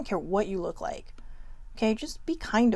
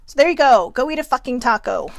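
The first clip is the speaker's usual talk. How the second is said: louder and higher, punched; punchy delivery; 4 semitones higher, 9 dB louder than usual. Real, not acted. Telling viewers to go eat a taco, emphatic. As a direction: deliver it like a mic drop.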